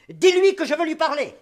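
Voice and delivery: loud firm order. Acted. Father orders firmly.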